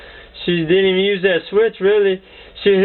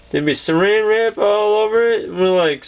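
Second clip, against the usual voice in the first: ordinary talk, nothing marked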